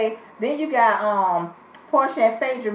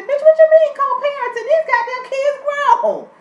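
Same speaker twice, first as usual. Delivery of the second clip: high, loud, incredulous outburst; outraged disbelief; 13 semitones higher, 6 dB louder than usual. Real, not acted. Outraged at the co-parenting talk.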